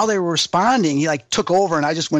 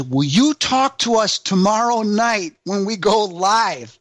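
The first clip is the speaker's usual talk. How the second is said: slow, raised, deliberate call to the spirits; imitated spirit questioning; 5 semitones higher, slower than usual. Investigator asking spirits about tomorrow's live show, slow imitated summons. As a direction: put the question slowly, pitched up. Zach addressing spirits, acted out.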